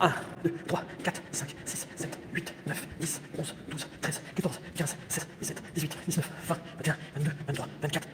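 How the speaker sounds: compter ses pas en chuchotant; compter à voix basse. Real, not acted. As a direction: murmurer les chiffres pour soi. (décompte chuchoté des pas)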